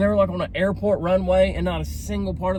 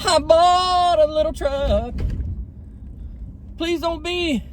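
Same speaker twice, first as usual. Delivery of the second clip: high, slow pleading voice; mock sweet confession; 12 semitones higher, slower than usual. Confessing truck purchase to wife, high slow pleading. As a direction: beg forgiveness sweetly.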